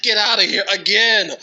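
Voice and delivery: very high, very loud, laughing; laughing disbelief. Retold or imitated. Reliving the top-three email, loud laughing squeal. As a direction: blurt it out high through laughter.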